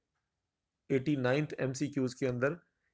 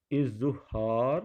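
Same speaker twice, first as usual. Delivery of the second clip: slow option reading; 3 dB louder, much slower than usual. Shown as a demonstration. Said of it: "اظہار جو ہے وہ بالکل درست".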